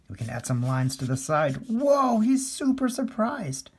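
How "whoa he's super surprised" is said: high-pitched mock surprise; a big, high "whoa" of amazement; playful amazement. Cartoonist reacting to surprised stick man, playful amazement. Voices surprise of drawn face.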